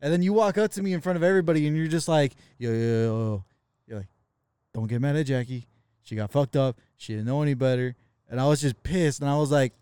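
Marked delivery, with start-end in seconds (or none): 2.6-3.4 low-voiced imitation of a peacemaker; dropped low, talking someone down; calming friend. Friend walks up to defuse things, placating. 4.7-7.9 low imitation of a placating friend; calming friend. Friend pleading Jackie's case, placating.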